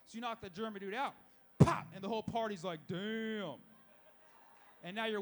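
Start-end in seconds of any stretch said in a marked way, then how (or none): 1.6-1.9 sharp, sudden vocal pop; quick mock punch noise; punch sound effect. Imitates the knockout punch. 2.9-3.5 drawn-out crowd reaction; stretched, mock-awed exclamation; mock stunned reaction. Party reacts to the knockout.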